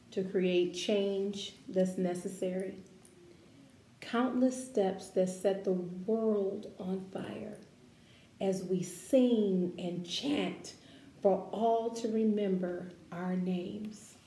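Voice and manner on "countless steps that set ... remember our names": measured, expressive read-aloud voice; gentle picture-book narration; reading to children. Acted. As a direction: read it like a storybook for a class.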